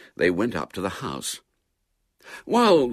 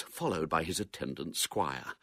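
ordinary delivery, nothing marked

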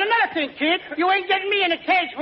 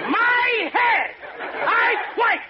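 panicked shout; 5 semitones higher, slower than usual. Acted. Costello shouts that he quits.

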